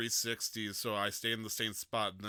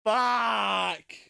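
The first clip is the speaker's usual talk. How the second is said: long, high, loud curse; shouted swearing; 12 semitones higher, 12 dB louder than usual. Acted. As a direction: yell one drawn-out expletive. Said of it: "saying the word fuck".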